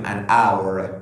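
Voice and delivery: an hour said as an our, no h sound; silent h shown. Shown as a demonstration. Teacher showing students the silent h in hour. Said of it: "کلمهٔ our صدای اولش چیه؟".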